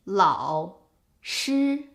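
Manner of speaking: 老师 split, falling-rising 老; full third tone. Shown as a demonstration. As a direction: say 老 and 师 separately, bringing 老 back up. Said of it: "is its actual pronunciation".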